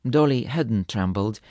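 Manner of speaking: ordinary delivery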